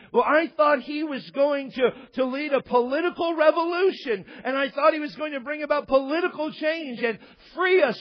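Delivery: a disciple crying out his broken hopes; loud disappointed disciple. Retold or imitated.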